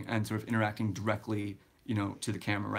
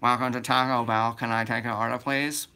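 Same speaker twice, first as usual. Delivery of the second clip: a lightly voiced, louder drive-thru greeting; drive-thru worker voice; 4 dB louder than usual. Drive-thru worker greeting a car over the speaker, lightly voiced. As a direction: put on the speaker-box greeting of a fast food worker, slightly louder. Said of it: "let me see if I can do some of the voice".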